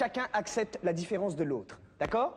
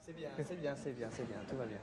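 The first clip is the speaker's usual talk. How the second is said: very soft, much lower murmur; soft low reassurance; soothing hush; 9 semitones lower, 12 dB quieter than usual. Acted. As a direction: hush him down gently. Soothing the upset rooster player.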